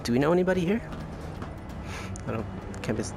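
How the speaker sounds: normal talking voice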